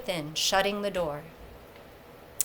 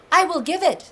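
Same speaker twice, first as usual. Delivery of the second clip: eager vow; 8 semitones higher, 5 dB louder than usual. A narrator's reading. Frey eagerly vows.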